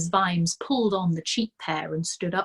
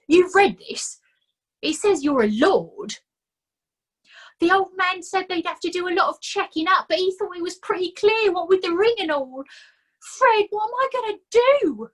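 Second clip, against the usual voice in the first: higher, aggrieved little man's whine; self-pitying complaint; whining Nobby; 13 semitones higher, 5 dB louder than usual. A narrator's reading. Nobby complaining to Fred about his bad luck, whining.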